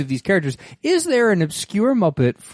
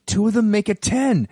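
normal talking voice

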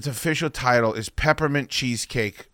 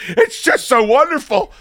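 mock tearful; 12 semitones higher, 7 dB louder than usual. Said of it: "And I was like".

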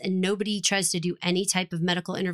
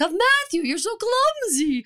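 mock scolding; 12 semitones higher, 4 dB louder than usual. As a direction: imitate a catty, exaggerated telling-off. Acting out handmaids' mean scolding.

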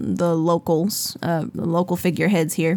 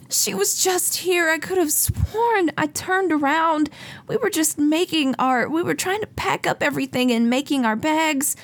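sobbing mother; 10 semitones higher than usual. Acted. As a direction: let it crack like a mother who lost her child. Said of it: "she's just sobbing".

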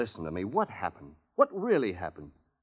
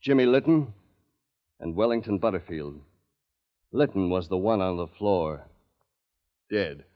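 hard-boiled private eye narration; tense noir voiceover, building to the kick; noir detective; 2 semitones higher than usual. A narrator's reading.

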